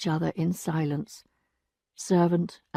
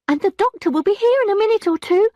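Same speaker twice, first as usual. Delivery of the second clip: high, loud servant's voice; the maid breaking bad news, high and loud; distressed maid; 13 semitones higher, 6 dB louder, faster than usual. A narrator's reading. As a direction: cry it out as the upset maid. Maid's voice.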